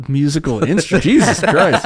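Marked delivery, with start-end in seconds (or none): 1.0-1.9 cracking up at your own flub; real laughing groan at himself; laughing exasperation. Real, not acted. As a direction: laugh it out, higher and louder. Laughing at own word mix-up.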